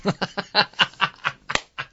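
high burst of laughter. Real, not acted. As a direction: crack up at the text. Laugh at [name] text.

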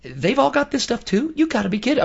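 retelling his shock at the DMV crowd; mock incredulous. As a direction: pitch it way up like you can't believe it. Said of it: "I'm like".

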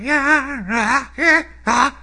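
high, raspy rock-singer yowl; strained raspy mock singer voice; frog-in-throat rasp. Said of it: "raspy voice".

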